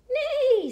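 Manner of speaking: high, loud whinny falling away; whinny the word out; horse neigh. A narrator's reading. Horse greets the truck, high neigh. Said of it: "said a horse".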